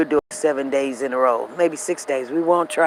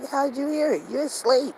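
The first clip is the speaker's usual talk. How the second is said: husband's surprise; 10 semitones higher than usual. Retold or imitated. Husband voiced in the story.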